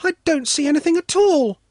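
put-on very high, disappointed child voice; complaining. A narrator's reading. Svetlana rising on tiptoes to see, high complaining child. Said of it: "Svetlana complained".

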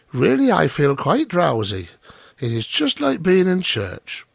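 admiring miller's wife. Acted. Wife praising the miller's talk over ale, drowsily admiring.

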